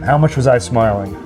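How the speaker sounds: ordinary talk, nothing marked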